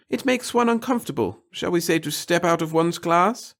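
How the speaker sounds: high, loud, fretful little man; fussy nervous clerk. A narrator's reading. The little clerk fretting to the reporter about his boss, high and anxious. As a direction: babble anxiously to please the reporter. Pettigill's voice.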